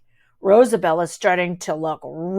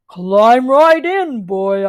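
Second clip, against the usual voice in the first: slow, louder heroic command; Captain Puff voice; 5 dB louder, much slower than usual. A narrator's reading. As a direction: coax him in like a hero. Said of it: "Captain Puff voice".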